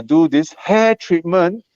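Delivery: usual speaking voice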